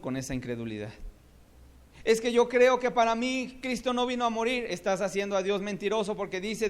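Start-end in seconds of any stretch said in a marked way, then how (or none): 2.0-4.7 higher, louder complaining voice; doubter's whine. Acted. Preacher mocking a doubter to the church, raised. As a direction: mimic a reluctant doubter. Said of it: "imagined doubter again".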